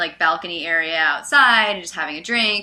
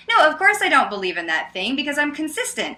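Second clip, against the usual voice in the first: higher, friendly mock protest; playful insistence; 6 semitones higher than usual. Smiling debater reassures her opponent, playful insistence. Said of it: "I'm really playful about it".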